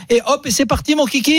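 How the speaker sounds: voix nettement plus aiguë, joyeuse; enjoué. Real, not acted. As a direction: lance la formule avec entrain. Conclusion enjouée, voix montée. (ton enjoué, plus aigu)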